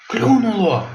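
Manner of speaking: high excited yelp; excited cry. Acted. Robin excited about his catch, high cry. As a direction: it bit, cry it out. Robin shouts he got bite.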